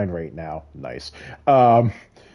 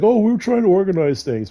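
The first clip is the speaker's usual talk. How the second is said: mocking excuse; 10 semitones higher, 3 dB louder than usual. Mimicking George's excuse.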